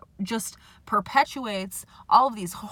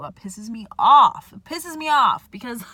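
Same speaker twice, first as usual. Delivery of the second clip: loud, fed-up outburst; real anger; 10 dB louder than usual. Real, not acted. Ranting about society's expectations.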